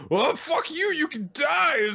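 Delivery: hammed-up, high-pitched screech; mock angry rant. Doubt ranting at his opponent, hammed-up furious yell. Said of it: "he goes on a rant".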